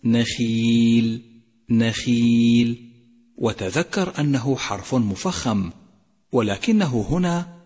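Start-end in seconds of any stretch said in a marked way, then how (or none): 0.0-2.9 slow recited نخيل, long ي, خ only lightly thickened; slightly heavy khaa. Shown as a demonstration. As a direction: chant نخيل drawn out with a mild خ. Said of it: "تأمل كسرة حرف الخاء". (ترتيل نخيل بأدنى تفخيم للخاء)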